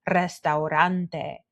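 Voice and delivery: "restaurante" with a trilled first R; "restaurante" in Spanish, starting on a tongue trill; rolled Spanish R. Shown as a demonstration. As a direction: roll the opening R of "restaurante". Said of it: "words that have the R in them".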